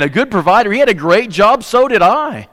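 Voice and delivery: lifted, pleading voice of a struggling wife; distraught wife. Acted.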